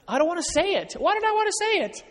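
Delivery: high squeaky panicked asides; comic inner panic. Retold or imitated. Husband panicking over what he must admit, squeaky and high.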